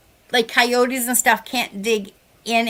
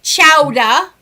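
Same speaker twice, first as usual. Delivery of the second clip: loud, R-less Massachusetts accent; 7 dB louder than usual. Shown as a demonstration. Woman showing off local words, loud Massachusetts accent. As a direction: punch it out in broad Massachusetts speech. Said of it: "Massachusetts accent".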